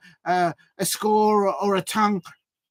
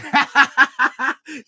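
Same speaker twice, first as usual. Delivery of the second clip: loud laugh; 6 semitones higher, 5 dB louder than usual. Real, not acted. Laughing at his own joke.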